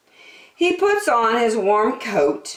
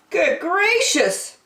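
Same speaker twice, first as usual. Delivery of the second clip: high and slow, astonished; Percy amazed; 12 semitones higher, slower than usual. A narrator's reading. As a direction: draw out the surprise. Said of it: "said Percy".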